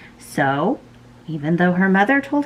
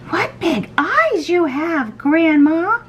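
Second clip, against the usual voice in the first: put-on high, slow, amazed little girl; 11 semitones higher, slower than usual. A narrator's reading. Red sees Grandma's big eyes, high girlish amazement. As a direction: marvel in a high, drawn-out girlish voice. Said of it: "she said".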